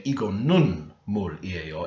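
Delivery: "nun" leaned on, slow and deliberate; slow Korean with stress on "nun". Shown as a demonstration. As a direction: hit the topic marker "nun" clearly. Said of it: "It's like a hard emphasis because it's now the topic".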